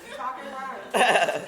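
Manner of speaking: loud excited shout. Real, not acted.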